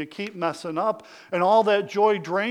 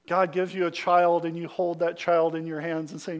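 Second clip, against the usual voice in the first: ordinary talk, nothing marked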